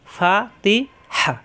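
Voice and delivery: ফা তি হা split into slow separate beats; slow, syllable by syllable. Shown as a demonstration. Teacher breaking Fatiha into parts for a student, slowly. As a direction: spell ফা তি হা out slowly, one beat each. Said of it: "ফাতিহা, হরকত উচ্চারণ তাড়াতাড়ি".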